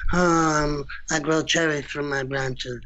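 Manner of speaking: mild Godfather Brando voice, lightly put on; lazy Brando mumble, half-hearted; Marlon Brando impression. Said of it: "doing a Marlon Brando impersonation".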